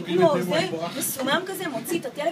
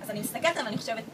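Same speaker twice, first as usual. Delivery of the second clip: normal talking voice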